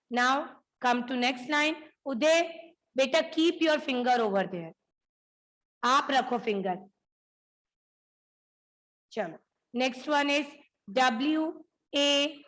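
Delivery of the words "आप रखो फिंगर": ordinary delivery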